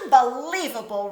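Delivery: a bear's rumbling complaint, pushed loud; rumbled. A narrator's reading. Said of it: "rumbled Bear".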